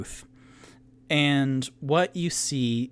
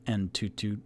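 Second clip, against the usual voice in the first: ordinary talk, nothing marked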